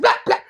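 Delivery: two quick, high slaps made by mouth; punchy comic sound effect; flapping sole noise. Retold or imitated. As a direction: mimic a broken shoe's percussive flap. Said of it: "That thing sound like [name]".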